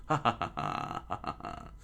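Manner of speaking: breathy mocking laughter. Shanks laughs at Luffy wanting to be a pirate, breathy mocking laugh. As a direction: laugh at the kid's dream. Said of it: "the Straw Hat Man laughs".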